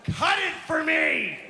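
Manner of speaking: high, loud, stretched-out demand; mock tantrum yell; angry little girl. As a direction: bellow like a furious toddler. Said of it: "she's a little ball of anger".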